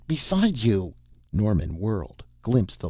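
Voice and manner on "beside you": high, quick-witted acted warning; urgent cry. A narrator's reading. Sarja shows Norman where the gun is, urgent high cry. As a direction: cry out a sharp prompt, pitch raised. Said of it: "cried the green man".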